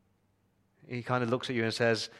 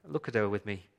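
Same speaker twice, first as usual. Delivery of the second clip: ordinary talk, nothing marked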